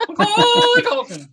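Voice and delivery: loud, very high mock bird scream; screaming macaw. Host playing the macaw's evolution, loud screeched squawk. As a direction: shriek the name like an evolved bird Pokemon. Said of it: "macaw would probably scream its friggin' head off".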